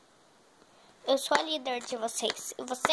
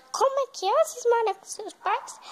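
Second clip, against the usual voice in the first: surprised; 7 semitones higher than usual. Acted. Shocked she lives with parents.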